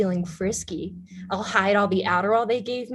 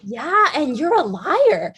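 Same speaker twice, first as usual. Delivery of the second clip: slow, loud, higher jab; cutting retort; 6 semitones higher, 5 dB louder than usual. Acted. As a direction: hit back, drawing it out. Jane fires back in fight.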